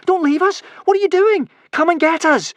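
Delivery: very high, loud desperate shouts; frantic high-pitched shouting. A narrator's reading. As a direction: yell after departing boats.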